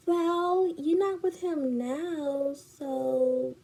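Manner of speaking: high, slow put-on comeback; mock dismissive friend. Friend shrugs off the cheating, mock dismissive. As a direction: mimic a friend brushing it off.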